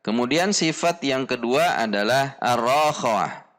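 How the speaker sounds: usual speaking voice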